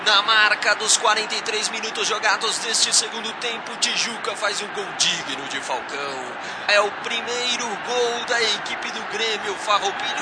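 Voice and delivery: high, ringing calls of the scorer's name; explosive goal commentary. Acted. (grito do nome do artilheiro)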